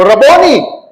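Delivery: joyful recognition. Acted. Mary recognizes Jesus and cries out joyfully. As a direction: burst out in recognition. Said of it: "kanyang sinabi sa wikang Hebreo".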